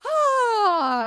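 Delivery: high, loud open "Ah" let out on the breath; a loud release sigh pitched well above the speaking voice; releasing sigh. Shown as a demonstration. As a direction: let all the tension go on a big high "Ah". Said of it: "I like to go".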